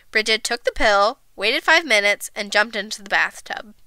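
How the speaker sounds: usual speaking voice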